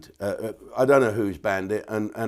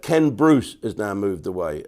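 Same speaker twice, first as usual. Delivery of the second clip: ordinary delivery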